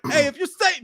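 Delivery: high mock Italian accent; Italian exorcist baiting a demon. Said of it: "Like, the way he's doing it".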